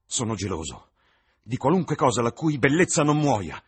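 despairing outburst. Acted. Dorian geloso del ritratto, in preda alla disperazione. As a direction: cry out against the portrait.

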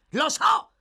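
high, loud snap; angry exclamation. Acted. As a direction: cut in with an exasperated shout. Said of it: "esclamò l'ebreo".

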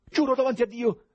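high, loud, desperate cry; desperate shout. A narrator's reading. President swears innocence to investigators, desperate shouting. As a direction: shout your innocence in despair. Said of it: "gridò disperato il presidente".

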